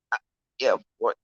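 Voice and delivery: talking through hiccups; words broken by a hiccup. Real, not acted. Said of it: "I got the hiccups".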